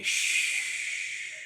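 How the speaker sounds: shh with a Chinese accent, breathy and drawn out. Shown as a demonstration. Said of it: "but we say".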